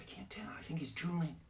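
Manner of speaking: soft whispered aside. Retold or imitated. Boy to classmate about the teacher, whispered. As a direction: hush it so he won't hear.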